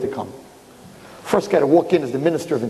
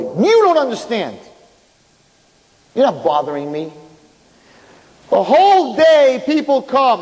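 emphatic; 9 semitones higher, 4 dB louder, slower than usual. Acted. King explaining to the boy.

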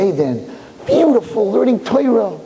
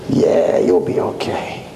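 much lower, whispered sneer; mock the angel's words in a dark hiss; mocking Satan; 10 semitones lower, slower than usual. Satan mocks Chaim with a much lower whispered sneer.

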